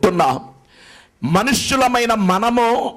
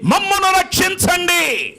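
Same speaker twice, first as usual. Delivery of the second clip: cry for help; 10 semitones higher than usual. Retold or imitated. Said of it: "ఇద్దరు అరవటం మొదలు పెట్టారు".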